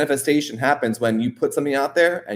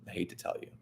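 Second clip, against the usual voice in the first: whispered aside; 4 semitones lower, 18 dB quieter, much faster than usual. Real, not acted. Confiding aside, voice drops.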